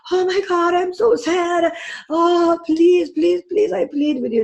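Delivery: high, whiny mock pleading; overdone pleading woman. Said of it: "if she was a Cancer rising, she might say".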